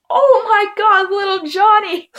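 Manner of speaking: high, loud, slow delighted exclaim; amused mom. Retold or imitated. Mom hearing the whole story, high amused exclaim. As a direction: gasp it out amused. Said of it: "she's like".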